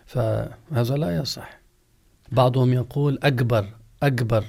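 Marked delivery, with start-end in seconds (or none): 3.2-4.4 أكبر said "agbar", g in place of k; hard g sound. Shown as a demonstration. Teacher imitates some people, saying agbar. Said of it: "مثل القاف المعقودة".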